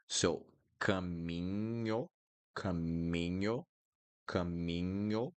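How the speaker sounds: slow caminho with nh like Spanish ñ; Portuguese nh. Shown as a demonstration. Teacher drills caminho slowly, Portuguese nh like ñ. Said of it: "you're going to do here with N H".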